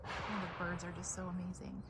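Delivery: ordinary talk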